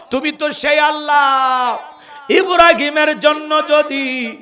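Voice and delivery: high, loud chanting cry, long drawn-out syllables; tearful shouted sermon narration; full-force preaching. Acted. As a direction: wail it out as an impassioned plea.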